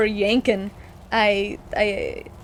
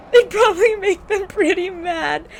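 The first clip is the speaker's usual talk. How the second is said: higher, louder, cracking up; laughing hard; 6 semitones higher, 5 dB louder than usual. Real, not acted. Repeating the joke to viewers while cracking up. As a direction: say it through real laughter.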